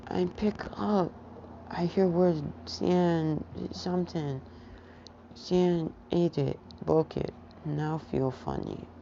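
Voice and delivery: put-on high, halting little kid voice; toddler voice. Toddler Izuku telling his dad what happened, halting kid voice. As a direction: talk like a small child in broken words. Toddler explains in broken speech.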